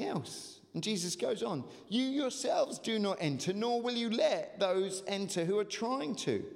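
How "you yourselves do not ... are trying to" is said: impassioned scripture reading. Acted. Preaching Jesus' woes.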